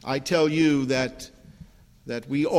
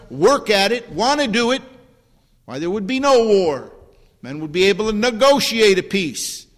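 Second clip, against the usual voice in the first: raised, loud pulpit delivery; forceful preaching; 5 semitones higher, 5 dB louder than usual. Real, not acted. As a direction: drive the point home to the congregation from the pulpit. Preaches on necessary war.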